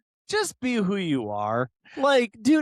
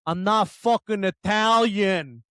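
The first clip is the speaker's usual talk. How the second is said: slow, drawn-out mock Italian-American accent; stretched-out Italian-American accent impression; imitated East Coast Italian accent; 3 dB louder than usual. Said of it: "sometimes sounds like he's from Boston".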